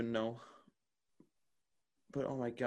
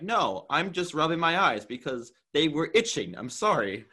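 raised, louder mock-defensive protest; exasperated protest; 6 semitones higher, 7 dB louder than usual. Defending the eye rubbing to an accuser, exasperated. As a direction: push back, pitched up and loud.

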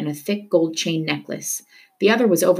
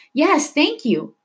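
eager cry; 10 semitones higher, much slower than usual. Acted. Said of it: "Mahmoud cried".

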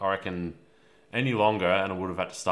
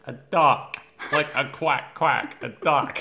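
higher, louder, slow duck explanation; mimicking Caleb; 7 semitones higher, 7 dB louder, slower than usual. Friend mimicking Caleb describing a duck, higher and louder. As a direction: quack it out like a flustered kid. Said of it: "Caleb was like".

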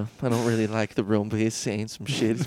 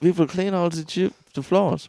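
put-on lightly German-accented robot; a hint of a German accent; 4 semitones higher than usual. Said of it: "in Germany, Roombas".

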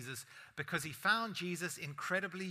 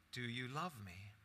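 quiet; 5 semitones lower, 10 dB quieter than usual. Acted. Jesus asks Peter third time.

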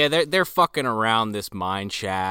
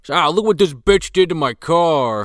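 loud, higher mock griping; Danny's complaint; 5 semitones higher, 6 dB louder than usual. Guy complaining his car is wrecked, mock outrage. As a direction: imitate an aggrieved car owner, loudly.

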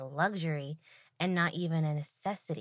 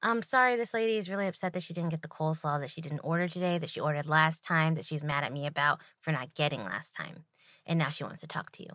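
rushed explanation; faster than usual. Retold or imitated. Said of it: "I'm like".

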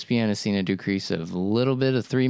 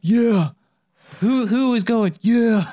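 mock yell; 13 semitones higher, 7 dB louder, much slower than usual. Mimicking the center's yell.

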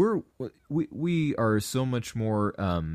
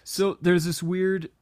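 high, slow eager start; excited; 7 semitones higher, 3 dB louder, slower than usual. Real, not acted. Excited at writing down the same line as the host. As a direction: burst in, thrilled at the shared line. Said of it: "I'm just so excited".

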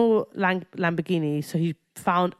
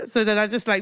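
usual speaking voice

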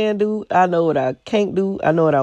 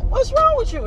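exasperated high yell. Retold or imitated. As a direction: throw it up high, fed up with him. Repeated louder, much higher.

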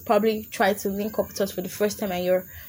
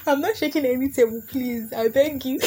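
higher, giggly begging; laughing mock plea; 4 semitones higher than usual. Real, not acted. Podcaster begging listeners not to take offence, laughing mock plea. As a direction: plead innocence through giggles.

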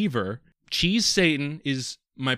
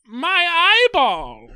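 slow, much higher, loud wail; 9 dB louder, much slower than usual. Pretend. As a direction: wail it slowly up high. Character wail.

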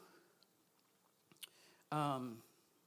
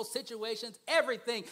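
ordinary talk

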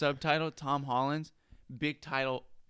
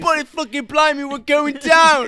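mock British accent; 9 semitones higher, 6 dB louder than usual. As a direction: wail like a cartoon Brit crashing. British bit in crash.

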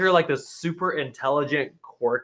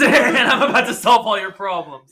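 laughing through a cocky line, a bit louder; laughing mock quote; 6 semitones higher, 6 dB louder than usual. Mocking Darcy's know-it-all line to a friend, laughing. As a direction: crack up while playing the smug hero. Mocking a smug movie character.

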